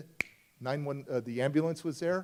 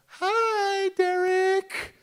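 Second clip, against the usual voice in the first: put-on much higher and louder, a small child's cheerful greeting; little girl voice; 9 dB louder, much slower than usual. Recovered girl greeting Derek at his door, little girl voice. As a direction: chirp it like a happy little girl.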